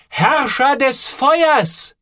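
very slow, high, drawn-out proclamation; name the dragon with awe; solemn naming. A narrator's reading. Boy names the boiler solemnly. (Joel tauft den Kessel, feierlich und langsam)

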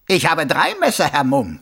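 high, loud mocking voice; a smug killer playing innocent; sneering villain. A narrator's reading. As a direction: Carcer mocking the watchman. Karzer triumphant.

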